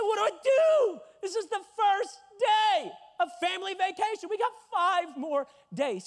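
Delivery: loud, high mock outburst; protestation paniquée rejouée. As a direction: wail it up high in exasperation. Protests to dad in retold story.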